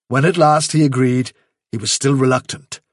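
ordinary delivery, nothing marked

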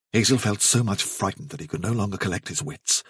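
usual speaking voice

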